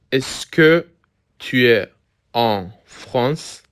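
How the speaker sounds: slow, word by word. Shown as a demonstration. Word-by-word teaching model.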